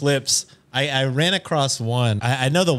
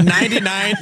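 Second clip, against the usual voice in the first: emphatic; 9 semitones higher, 5 dB louder than usual. Real, not acted. Emphatic exaggeration.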